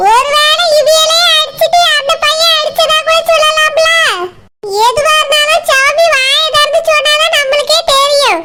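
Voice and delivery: high, slow gossiping village woman's voice; fish seller, sly and suspicious. Acted. Fish seller hints to the neighbours that the owner hit the girl. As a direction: plant the doubt slowly and slyly.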